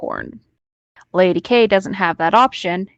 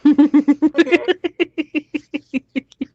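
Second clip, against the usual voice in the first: burst of laughter; 6 semitones higher than usual. Real, not acted. Laughing at own lotion mess.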